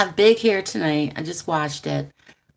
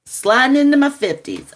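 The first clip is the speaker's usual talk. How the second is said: higher, louder hammed-up declaration; dramatic announcement; 7 semitones higher, 8 dB louder than usual. Real, not acted. She jokes to viewers about aging, hammed-up. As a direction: announce it like a grand doom. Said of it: "joking about age".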